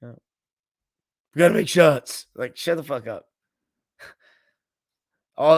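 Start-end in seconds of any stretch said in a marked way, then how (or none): 0.0-2.3 hammed-up sideline pep talk; ashy Doc Rivers voice. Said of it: "That ashy-ass voice".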